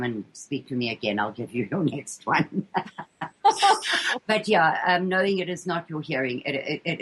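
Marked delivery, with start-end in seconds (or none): 2.7-4.2 a high, bright burst of laughter. Real, not acted. Laughing after joking about elocution lessons, high laugh. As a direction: laugh out at your own joke. Laughing at her own joke.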